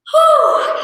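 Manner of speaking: sharp high breathy whew, out of breath; real breathless whew after high knees; winded whew. Real, not acted. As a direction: blow out hard after the jumping. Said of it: "Catch my breath".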